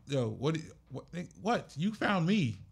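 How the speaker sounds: acted brash challenge, pitch climbing; aggressive, harsh. Manager refusing to baby an artist, aggressive. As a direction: push back bluntly, breaking into laughter. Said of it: "I'm a little more aggressive and, and harsh".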